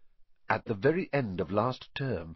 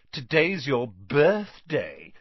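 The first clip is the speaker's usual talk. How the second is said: slow, louder, higher-pitched sneer; 4 semitones higher, 6 dB louder, slower than usual. A narrator's reading. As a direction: rub it in with a drawn-out sneer. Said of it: "sneered Dudley".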